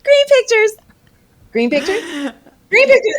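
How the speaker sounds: high, slow sing-song chant of the fans; mocking fans. Mocking fans' hashtag posts.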